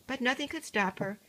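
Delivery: ordinary delivery, nothing marked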